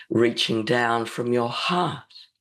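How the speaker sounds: normal talking voice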